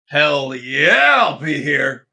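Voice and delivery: loud, jubilant holler. Acted. Eager clerk hollering yes to his crush, loud. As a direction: cheer it out loud. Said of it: "Parker wanted to holler".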